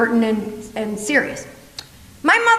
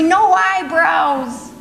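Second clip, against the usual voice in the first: slow punchline; 2 semitones higher, much slower than usual. Acted. Punchline about mother's eyebrows.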